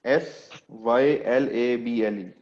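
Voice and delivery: letters spelled out slowly, one by one; slow spelling. Shown as a demonstration. Teacher spelling syllable for the class, slowly. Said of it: "मैंने भी word लिखा भी था".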